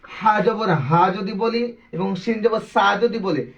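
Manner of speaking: ordinary talk, nothing marked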